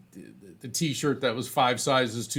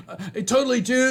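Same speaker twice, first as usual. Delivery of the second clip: high, loud laid-back surfer dude; 11 semitones higher, 6 dB louder, faster than usual. Retold or imitated. Teen store clerk agreeing with the medium, high stoked dude voice.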